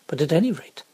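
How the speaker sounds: ordinary delivery, nothing marked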